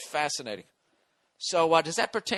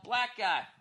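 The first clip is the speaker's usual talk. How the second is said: mock yell; 6 semitones higher, 4 dB quieter than usual. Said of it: "I yell".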